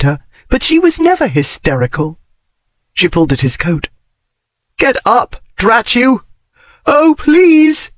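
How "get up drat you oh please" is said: desperate plea. Acted. Desperate over fallen man.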